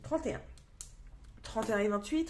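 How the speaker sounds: usual speaking voice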